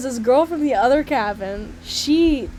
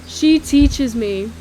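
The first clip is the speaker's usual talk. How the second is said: slow, loud, drawn-out words; slow retake; 6 dB louder, much slower than usual. Real, not acted. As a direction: get it out slowly after a laughing fit. Slow retake after laughing fit.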